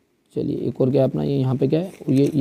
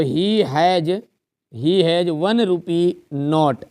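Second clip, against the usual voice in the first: the sentence spelt out slowly for the class; slow dictation; much slower than usual. Shown as a demonstration. Teacher reading a blank-filling sentence to students, slow. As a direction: read it out word by word, very slowly. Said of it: "dictating sentence slowly".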